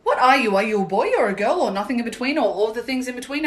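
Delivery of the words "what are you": ordinary talk, nothing marked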